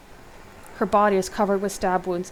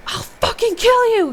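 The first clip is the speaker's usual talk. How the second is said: very high, drawn-out furious scream; enraged scream; 9 dB louder, slower than usual. A narrator's reading. Hero rushing at Rick in a rage, screaming. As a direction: scream it slowly at the top of your lungs. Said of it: "you scream as you rush him".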